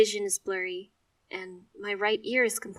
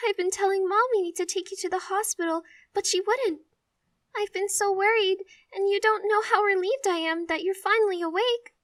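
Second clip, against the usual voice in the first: high, loud worried sobbing; tearful; 12 semitones higher, 8 dB louder than usual. Acted. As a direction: pour out relief through tears. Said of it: "sobbing as she speaks".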